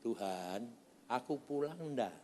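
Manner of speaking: soft, gentle asking of God; retold prayer. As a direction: pray quietly, as if in private. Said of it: "saya tanya, Tuhan".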